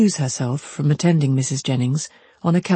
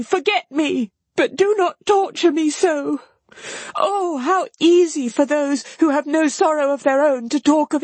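high, anguished pleading; acted despair, pitched far up; distressed cry; 11 semitones higher than usual. A narrator's reading. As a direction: beg and reproach in a high cry. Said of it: "cried Marianne".

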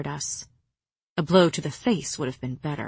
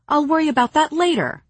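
higher, louder snippy retort; sniped back; 9 semitones higher, 5 dB louder than usual. Acted. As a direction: snap it back tartly. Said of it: "I sniped back".

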